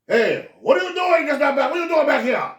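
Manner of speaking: principal yelling. Retold or imitated. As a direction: bark at the boys like a vice principal. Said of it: "assistant principal saw us".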